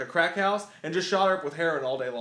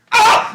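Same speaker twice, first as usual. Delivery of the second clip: high, loud mock scream; sudden hammed-up yell of pain; 14 dB louder than usual. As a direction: scream like a bitten B-movie victim. Imitating bitten victims' reaction.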